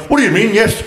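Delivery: very high-pitched imitated disbelief; mock indignant. Stranger questioning the listener's yes, high mock disbelief. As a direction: act out a taken-aback questioner. Acts out baffled person's reply.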